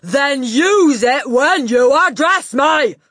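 high, slow, full-volume shout; furious shout. A narrator's reading. Headmistress demanding proper address, furious shout. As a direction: hammer each word at top volume.

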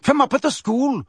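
high-pitched, heated scorn; contemptuous. A narrator's reading. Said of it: "interrupted Bane contemptuously".

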